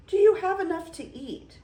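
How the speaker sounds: high, fast, loud eager questions. Acted. Beast eager host.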